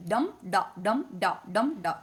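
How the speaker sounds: steady beat, each "dum" stressed and each "da" dropped; trochaic rhythm. Shown as a demonstration. Teacher demonstrating trochaic meter, stressed "dum", unstressed "da". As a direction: chant it in pairs, weight on every "dum", light "da". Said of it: "stressed syllable is follows, followed by an unstressed".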